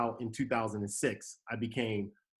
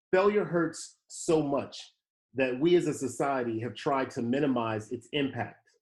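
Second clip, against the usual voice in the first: reading aloud from his book. Acted. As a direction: read the passage as if to an audience.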